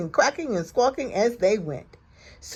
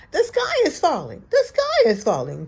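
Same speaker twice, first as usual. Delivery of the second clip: high panicky chick cry; crying Chicken Little; 7 semitones higher, 6 dB louder than usual. A narrator's reading. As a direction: cry the alarm again, frightened. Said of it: "cried Chicken Little".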